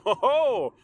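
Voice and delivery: loud, high burst of laughter; hearty laugh. Real, not acted. Vlogger catching his own mistake, laughing loud and high. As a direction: let out a big loud ho ho.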